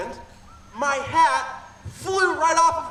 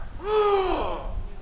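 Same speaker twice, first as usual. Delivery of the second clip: gasp; 5 semitones higher, 3 dB quieter than usual. Acted. Storyteller reacts to the bear.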